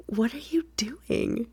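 hushed teasing question; whispered. Acted. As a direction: whisper it slowly. Repeats her question softly.

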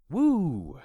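put-on high, loud drunken woo; long, high cheer; gleeful whoop. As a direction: whoop it out with tipsy delight. Drunk narrator thrown through the air.

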